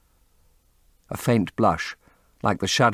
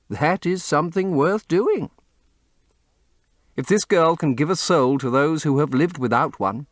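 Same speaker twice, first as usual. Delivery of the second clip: put-on a lightly raised, sincere older friend; earnest painter; 6 semitones higher than usual. A narrator's reading. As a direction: warmly reassure the young man. The painter Basil's earnest speech.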